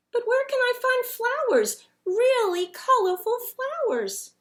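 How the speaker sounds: high, pleading snowman voice. A narrator's reading. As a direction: beg to find the flowers.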